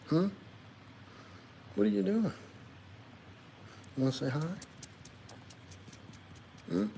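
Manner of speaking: high cooing pet talk, soft. Real, not acted. Streamer coos softly at his pet dog, baby talk. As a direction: coo at the little dog sweetly.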